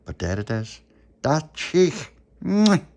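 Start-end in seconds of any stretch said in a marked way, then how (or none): 1.2-2.9 put-on cooing at baby.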